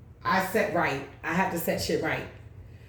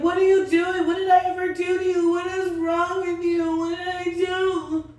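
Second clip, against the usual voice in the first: high, whiny mock outburst, louder at first; mocking rival's whine; 12 semitones higher, 5 dB louder than usual. Imitating the old rival shrieking at her in the store, high and whiny. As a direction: mimic her hurt squeal, much higher.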